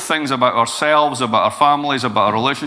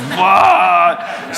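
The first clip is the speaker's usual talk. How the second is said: angry what; 5 semitones higher, 5 dB louder than usual. Retold or imitated. Angry driver's retort.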